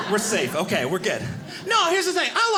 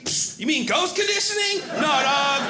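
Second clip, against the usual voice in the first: mock tour guide; 6 semitones higher than usual. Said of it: "Be like".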